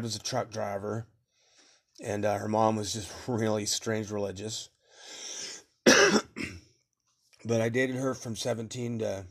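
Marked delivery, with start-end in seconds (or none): 5.8-6.5 sudden hard cough; short burst of coughing. Real, not acted. Man pausing mid-story, sudden cough.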